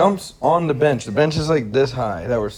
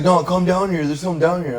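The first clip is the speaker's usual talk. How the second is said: high pleading voice of a shady stranger; creepy stranger luring, higher voice; homeless guy; 8 semitones higher than usual. Retold or imitated. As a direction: coax them down the alley, high-pitched. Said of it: "He was like".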